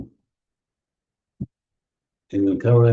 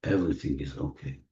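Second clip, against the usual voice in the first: Everything is okay, repeated much softer and lower; softer echo; 5 semitones lower, 11 dB quieter than usual. Acted. Leader repeating the affirmation to the group, fading softly. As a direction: let the affirmation fade down to a murmur. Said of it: "repeated affirmation, softer echo".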